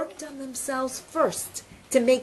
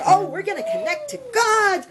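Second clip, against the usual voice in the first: higher, mildly excited storyteller's quote; acted delight, pitched up; happy realization; 7 semitones higher than usual. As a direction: voice a dawning happy idea. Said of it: "So they get so happy".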